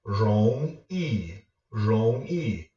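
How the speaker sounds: "Rong" rising, "yi" falling; second plus fourth tone. Shown as a demonstration. Teacher giving viewers an example word, rise then fall. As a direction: climb on "Rong", drop down on "yi". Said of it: "a second tone with a fourth tone".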